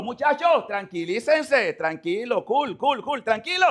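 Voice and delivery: high, sing-out reassuring call; Jesus calming disciples. Acted. Jesus telling frightened disciples to calm down, high-pitched calming call. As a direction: call out to settle the lads down. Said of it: "pero Jesús le dijo".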